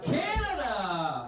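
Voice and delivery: slow drawn-out cry of "Canada"; hammed-up dismay at a Canadian in the crowd; mock outrage. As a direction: stretch it out in fake shock.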